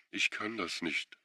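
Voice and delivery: quiet broken sob; sobbing. A narrator's reading. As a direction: choke the words out through sobs. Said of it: "schluchzte sie".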